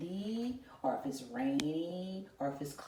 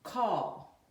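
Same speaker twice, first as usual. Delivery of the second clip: the word Call called out louder; clear dictation; 5 semitones higher, 5 dB louder than usual. Shown as a demonstration. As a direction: dictate Call for the class to spell. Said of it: "I'm going to say it".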